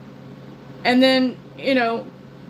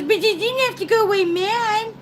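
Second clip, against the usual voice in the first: high, whining husband imitation; whiny husband; 10 semitones higher than usual. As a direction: play the hurt husband, pitched up. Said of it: "He goes".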